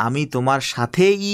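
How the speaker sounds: সাথেই said slowly and leaned on; teacher stressing সাথেই. Shown as a demonstration. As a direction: press the word সাথেই, slowly. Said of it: "শব্দটা একটু জোর প্রদান করেছেন".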